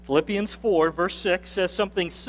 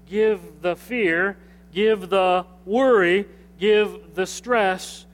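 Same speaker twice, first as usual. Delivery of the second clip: slow emphatic list; slower than usual. Acted. Preacher stresses giving to God.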